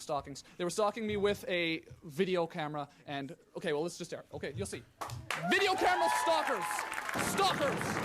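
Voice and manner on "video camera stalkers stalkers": mock alarm shout. Host shouts about stalkers.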